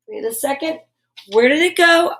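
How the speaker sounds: usual speaking voice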